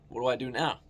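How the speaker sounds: ordinary talk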